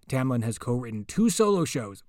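ordinary delivery

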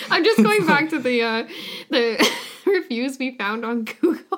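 laughing through the words; giggling while explaining. Real, not acted. Laughing at the Google reviews.

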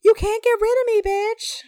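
high, loud joking rap-diva impression; loud, high-pitched playful impersonation. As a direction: belt it out high as a mock quote.

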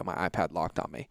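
ordinary talk, nothing marked

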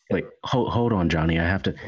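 ordinary talk

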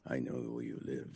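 heavy breathing phone whisper; Liam Neeson impression. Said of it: "Liam Neeson".

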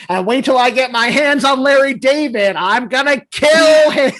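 loud, high mock scream; retold scream. Wife screaming threats at Larry from the lawn, loud. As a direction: yell the threat like a furious wife. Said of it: "her scream".

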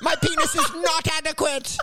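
high, slow, laughing whine of self-doubt; self-pitying weakling. Pretend. Timid genius doubting his own advice, high and whiny. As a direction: hedge and cringe, half-laughing. Meek genius belittling himself.

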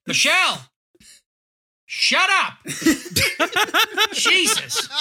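high, startled outburst at the screen; shocked yell. Real, not acted. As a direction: shriek in disbelief.

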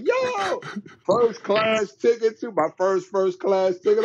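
very high excited yo; hyped. Retold or imitated. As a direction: gush like a kid who just got lucky. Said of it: "I'm like".

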